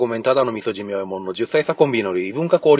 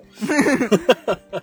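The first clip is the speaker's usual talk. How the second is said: laughing squeak; 13 semitones higher, 7 dB louder than usual. Real, not acted. Laughing, squeaky high aside.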